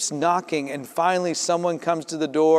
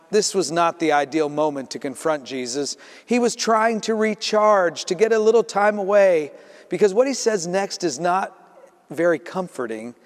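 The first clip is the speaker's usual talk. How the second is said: ordinary delivery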